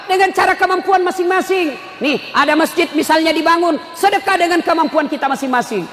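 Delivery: high, driving sermon delivery; full-force preaching. Acted. Preacher urging officials to help build the mosque, full-force preaching. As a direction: exhort the crowd with rising fervour.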